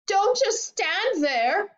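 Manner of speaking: high, drawn-out screech; frantic high scolding; screamed. A narrator's reading. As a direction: screech slowly at a useless husband. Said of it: "Missus Globe screamed at Mister Globe".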